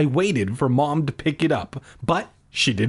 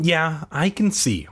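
ordinary talk

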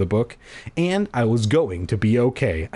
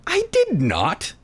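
a high, slow, whiny denial; lightly voiced whining sister; whiny girl; 11 semitones higher than usual. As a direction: whine it like a sulky kid. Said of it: "Gwynny whined".

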